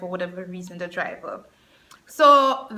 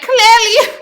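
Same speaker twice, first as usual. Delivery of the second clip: laughing agreement; 11 semitones higher, 8 dB louder than usual. Retold or imitated. Agrees with the driver.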